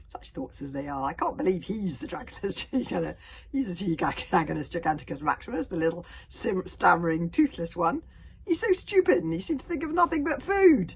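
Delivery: loud, derisive dragon scorn; mocking dragon. A narrator's reading. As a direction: ridicule the little one with laughing disbelief. Dragon Furious mocks Toothless.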